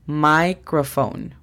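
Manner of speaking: O F O N spelled slowly, then Microphone drawn out slowly; slow demo. Shown as a demonstration. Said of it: "La pronunciación fonética es". (demostración lenta de pronunciación)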